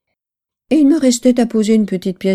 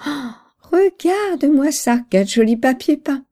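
delighted admiration; 5 semitones higher than usual. A narrator's reading. Mother admiring the new wallpaper, delighted. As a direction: exclaim as if applauding lovely work. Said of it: "elle avait applaudi en disant".

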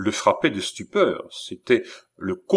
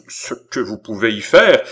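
loud, outraged retort; indignant cry; 7 dB louder than usual. Acted. Philippe flares up at the count's question, crying out. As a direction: burst out loudly. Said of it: "s'écria Philippe".

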